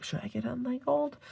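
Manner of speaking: high, questioning inner voice; wondering to myself. Retold or imitated. Debating with herself over gold foil, high questioning voice. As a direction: pitch the self-doubt up high. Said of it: "half the time I'm like".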